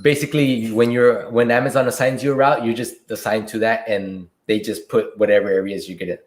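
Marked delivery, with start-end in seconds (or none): none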